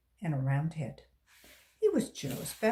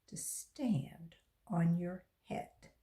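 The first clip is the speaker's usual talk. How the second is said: hushed punchline; 4 semitones lower, 6 dB quieter, slower than usual. Acted. Teacher's punchline dropped to whisper.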